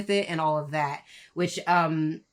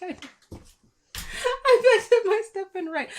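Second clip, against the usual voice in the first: high, giggling repeat of punchline; cracking up mid-joke; laughing; 13 semitones higher than usual. Real, not acted. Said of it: "nearly got me".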